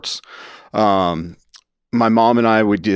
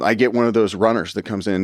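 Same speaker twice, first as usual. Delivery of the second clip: ordinary talk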